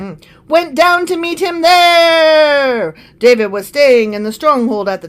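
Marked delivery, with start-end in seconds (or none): 0.5-2.9 slow, higher announcer boom. Acted. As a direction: stretch it out grandly. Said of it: "the announcer voice".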